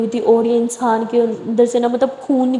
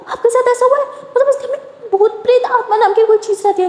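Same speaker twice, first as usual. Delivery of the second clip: very high, hammed-up frightened gasp of a question; overdone fake panic, much higher pitch; mock fright; 11 semitones higher than usual. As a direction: pretend to be terrified to scare her. Lakshmi fakes fright to scare Lily.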